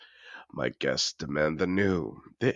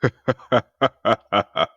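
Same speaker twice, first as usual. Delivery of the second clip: high, loud, falling burst of laughter; amused laugh; 7 semitones higher, 10 dB louder than usual. Acted. Host amused by the guest's surprise, loud laugh.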